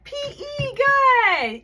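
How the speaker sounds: high, loud, cheering praise; excited praise. Acted. Teacher cheering her young student's right answer, high and loud.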